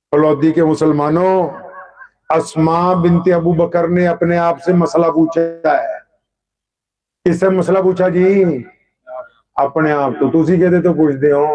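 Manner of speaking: ordinary talk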